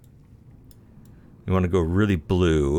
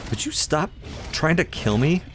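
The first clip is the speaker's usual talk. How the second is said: high-pitched, exasperated plea; exasperated protest; 9 semitones higher than usual. Real, not acted. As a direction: protest in a raised pitch at a pest. Protests at a creature attacking in game.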